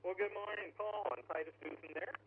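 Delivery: ordinary delivery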